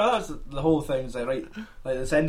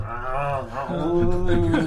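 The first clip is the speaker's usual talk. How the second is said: zombie moaning; much slower than usual. Pretend. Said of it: "I'm sure they're just going".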